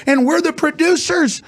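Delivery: high, strained indignation building to slow emphasis; indignant rant. Real, not acted. Host railing at those telling producers how to live, indignant climax.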